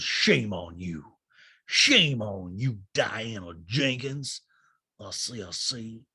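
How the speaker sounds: low, very slow mock scolding; Sutton. Housewife shaming Diana, slow low-pitched imitation. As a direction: draw out each word deep and haughty. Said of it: "And Sutton goes".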